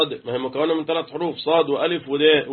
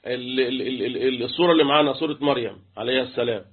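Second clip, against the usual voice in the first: ordinary talk, nothing marked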